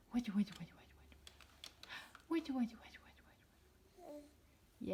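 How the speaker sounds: playful whispered cooing. Real, not acted. Mother whisper-cooing to baby.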